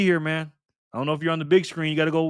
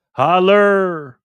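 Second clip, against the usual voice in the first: loud greeting; 4 semitones higher, 9 dB louder than usual. Real, not acted. Greeting a chat member by name.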